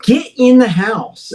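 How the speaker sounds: slow, high-pitched command to dogs; mock yell, drawing out each word; retold yelling. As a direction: order the dogs in, high but not truly shouting. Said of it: "so I'm yelling at them".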